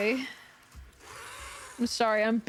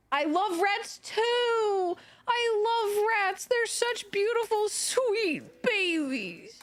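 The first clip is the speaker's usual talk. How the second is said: gushing over rats; 8 semitones higher, slower than usual. Real, not acted. Gushing over the killed rat.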